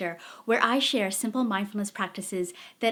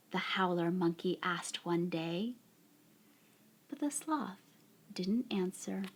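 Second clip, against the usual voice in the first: unhurried picture-book reading, softly spoken; story read to children; 4 semitones lower, 9 dB quieter than usual. A narrator's reading. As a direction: draw out each phrase gently, leaving long pauses.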